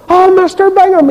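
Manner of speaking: high-pitched imitation of a Ballymena greeting; Ballymena accent. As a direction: call out high, mimicking a Ballymena man. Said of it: "in his Ballymena accent".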